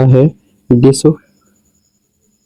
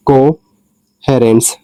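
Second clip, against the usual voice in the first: Coherence drawn out very slowly; slow demo pronunciation; much slower than usual. Shown as a demonstration. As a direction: say coherence slowly so students copy it. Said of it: "ऐसे ही अगला है". (Coherence को धीरे-धीरे बोलें)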